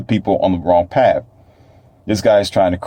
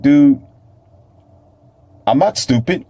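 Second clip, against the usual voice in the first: mock retort; 6 semitones higher than usual. Acts out retort to the preacher.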